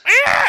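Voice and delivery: high loud gull screech; seagull call attempt. Shown as a demonstration. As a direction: shriek it like a seagull. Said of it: "I can't do an actual seagull call".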